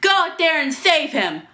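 urgent command. Acted. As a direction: send him off on a rescue. Urgent order to rescue mayor.

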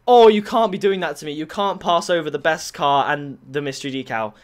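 ordinary delivery

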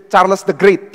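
ordinary talk

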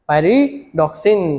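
"pyridoxine" stretched very slowly, syllable by syllable; drawn out. Shown as a demonstration. Teacher stretching the name so students memorise it. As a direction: drag "pyridoxine" out slowly with a rise at the end. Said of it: "याद रखेंगे".

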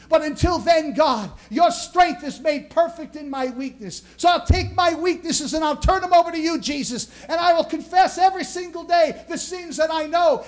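full-force preaching. Acted. Pastor preaching to the congregation, full-force sermon. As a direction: lift the room, hammer each phrase like a revival preacher. Prayer rising into proclamation.

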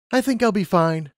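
put-on high, eager young mare; Lumina's voice. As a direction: voice her up high, keen and bright-eyed. Lumina answers confidently.

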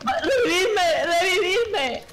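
shrieking high after getting shot; panicked high yelps. Real, not acted. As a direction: yelp in real panic, very high.

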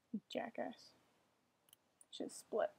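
whisper. Real, not acted. Whispered aside.

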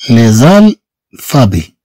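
لي زالفابي stretched out slowly with the z linking; slow demo. Shown as a demonstration. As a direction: spell out لي زالفابي slowly, sound by sound. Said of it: "لي زالفابي نضيف اس هنا". (نطق بطيء لـ لي زالفابي)

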